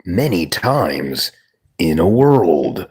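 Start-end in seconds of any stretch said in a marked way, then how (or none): 1.8-2.9 slow, low movie trailer read; deep trailer voice. Shown as a demonstration. Showing chat how to say it, trailer voice. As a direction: boom it like a film trailer announcer. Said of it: "Like that".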